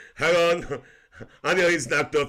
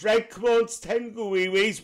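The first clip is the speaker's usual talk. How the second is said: lightly sing-out, higher coaxing; imitated coaxing voice; 8 semitones higher, 4 dB louder than usual. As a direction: re-enact chivvying a pet along, pitched up. Morning coaxing of the dog.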